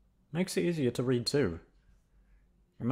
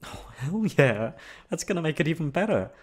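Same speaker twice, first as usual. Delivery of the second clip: excited cheer; 6 semitones higher, 4 dB louder than usual. Real, not acted. Excited about snagging projector room.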